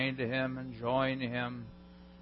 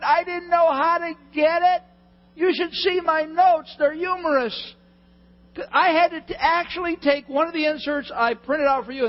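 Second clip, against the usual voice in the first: high, loud comic meltdown; hysterical rant; 12 semitones higher, 8 dB louder than usual. Acted. Preacher explaining missing PowerPoint slides to congregation, hysterical shouting. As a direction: rant at the top of the voice, pitched way up.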